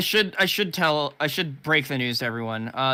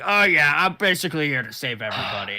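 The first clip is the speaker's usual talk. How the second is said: Strong Bad voice. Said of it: "and be like".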